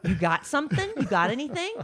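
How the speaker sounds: teasing impersonation, pitch climbing at the end; exaggerated prying question, rising; mocking imitation. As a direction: mimic a demanding partner's question. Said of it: "That's always what you say".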